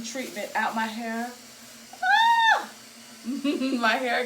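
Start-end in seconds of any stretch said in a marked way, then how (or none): 2.0-2.7 sudden high startled yelp; sharp surprised cry. Real, not acted. Hair caught in the bag.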